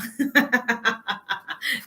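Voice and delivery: bright rising laugh. Real, not acted. Host laughing at a viewer calling himself hot. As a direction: laugh it off, amused.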